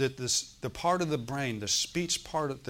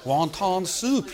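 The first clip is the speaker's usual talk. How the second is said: put-on slow, higher caricatured Chinese accent; hammed-up Chinese-accented English, louder; mock Chinese accent; 5 semitones higher, 3 dB louder, much slower than usual. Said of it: "Now, that's China".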